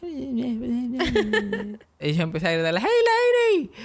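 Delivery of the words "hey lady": goofy comic heckle; Jerry Lewis. Retold or imitated. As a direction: call out as a zany comedian impression. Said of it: "básicamente haciendo Jerry Lewis".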